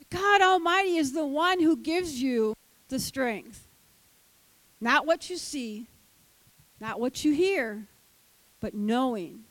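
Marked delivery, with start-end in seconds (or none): none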